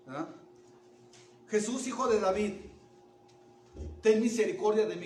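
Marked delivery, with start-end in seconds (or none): 1.5-5.1 loud cry for mercy; shouted plea. Acted. Blind man to Jesus despite being silenced, shouting out. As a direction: shout it out to be heard. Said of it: "gritó".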